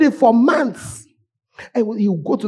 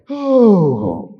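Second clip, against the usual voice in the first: ordinary delivery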